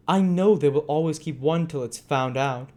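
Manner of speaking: sneering Monks. A narrator's reading.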